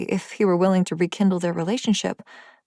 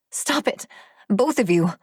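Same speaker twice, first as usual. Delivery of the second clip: shout to stop them; 3 semitones higher than usual. Acted. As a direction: break up the fight with a cry. She steps between fighting males.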